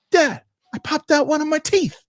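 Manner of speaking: put-on very high excited child voice; seven-year-old daughter. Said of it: "the seven-year-old".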